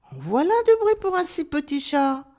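high-pitched owl remarking on the racket; lightly acted mild reproach; chiding owl. A narrator's reading. Owl's line in story. (chouette qui gronde, voix aiguë)

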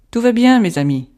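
soldier calling out loud to comrades; shouted. A narrator's reading. Soldier shouting to his comrades, loud reassurance. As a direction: call it out to the men. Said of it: "cria-t-il".

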